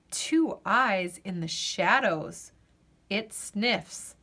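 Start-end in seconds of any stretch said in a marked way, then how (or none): none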